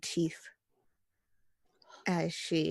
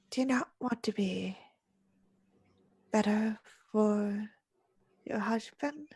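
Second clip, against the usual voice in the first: higher, halting, hushed coaxing; disused whispery voice; 4 semitones higher than usual. Acted. Veiled woman questioning a bride about her husband, whispery. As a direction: coax her in a little-used voice. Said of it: "whispers in a voice that feels like it had not been used".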